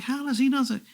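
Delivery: stunned that the star knows him; astonished. Retold or imitated. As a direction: gasp it out in amazement. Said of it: "I'm like".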